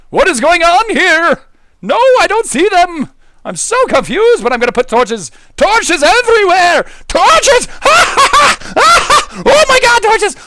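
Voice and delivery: giddy laughing shout; hyper excited shouting. Real, not acted.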